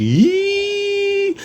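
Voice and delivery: mock surprised squeal.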